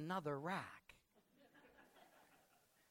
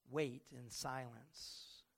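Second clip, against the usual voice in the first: slow emphasis; 5 semitones lower, 5 dB quieter, much slower than usual. Acted. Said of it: "slowed for emphasis".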